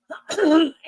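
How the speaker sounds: clearing a sore throat; rough throat clear. Real, not acted. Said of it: "sorry, I'm losing, losing my voice".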